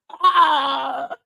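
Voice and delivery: loud, high burst of laughter; big laugh. Real, not acted. Cracking up after reading Sheila the comment, real loud laugh.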